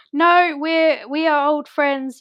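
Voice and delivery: lightly imitated weeping Emma, slowing down; tearful plea. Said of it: "in tears and she's like".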